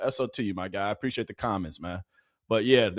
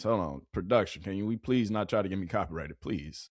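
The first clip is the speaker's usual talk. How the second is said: quiet plea; 5 semitones lower, 5 dB quieter than usual. Real, not acted. Asking production to cut the music.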